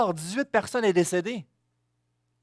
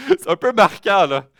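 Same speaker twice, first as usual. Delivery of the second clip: laughing; 8 dB louder than usual. Real, not acted. Laughing after joke.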